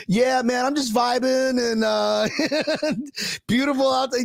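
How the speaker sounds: easygoing stranger playing along; mock chill athlete. Host voicing the tall man answering reporter, laid-back. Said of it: "He's like".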